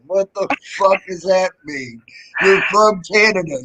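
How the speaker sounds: normal talking voice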